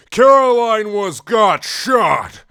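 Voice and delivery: slow, high, very loud dramatic reveal; shocked shout. A narrator's reading. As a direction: shout each word out in hammed-up horror. Sudden shooting in the story.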